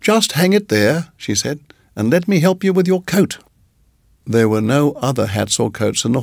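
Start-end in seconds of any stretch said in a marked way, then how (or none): 0.0-1.1 lightly voiced kindly landlady, higher; a hint of a doting hostess; sweet landlady. A narrator's reading. 1.9-3.4 lightly voiced kindly landlady, higher; a hint of a doting hostess; sweet landlady. A narrator's reading.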